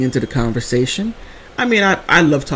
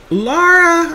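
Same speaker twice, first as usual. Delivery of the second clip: much higher, pleading call; mom calling for help; 14 semitones higher than usual. Retold or imitated. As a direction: call your daughter in panic. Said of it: "She's like".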